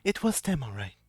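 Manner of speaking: soft reply. Acted. Thalia answers softly.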